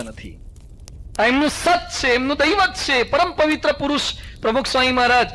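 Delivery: forceful preaching. Acted. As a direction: drive the lesson home like a preacher. Preaching praise of the guru. (જોશીલું પ્રવચન)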